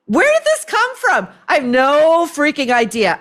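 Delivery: mock-innocent shrug, voice jumping high; sarcastic mock bafflement. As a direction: play dumb, dripping sarcasm. Sarcastic mock of Ryan's claim.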